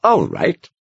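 lightly voiced old monk, a twinkle in it; amused old man. A narrator's reading. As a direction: give in with a knowing smile. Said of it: "his eyes twinkling slightly".

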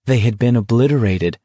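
ordinary delivery, nothing marked

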